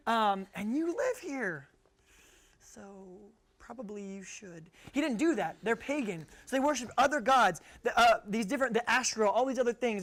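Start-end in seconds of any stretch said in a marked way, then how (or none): 0.5-4.7 halting, drawn-out awkward hint. Acted. Israelites hinting that residents should leave, slow and awkward. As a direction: trail off softly into an embarrassed suggestion. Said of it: "I know, awkward".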